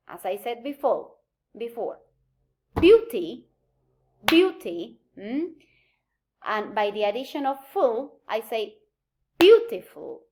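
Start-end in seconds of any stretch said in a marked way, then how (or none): 2.8-5.0 BEAUTY with a loud, clear first syllable; stress on first syllable. Shown as a demonstration. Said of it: "do not change the stress pattern". 9.4-10.2 "beautiful" hit loud on BEAU; stress on first syllable. Shown as a demonstration. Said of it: "I do not affect the original stress pattern".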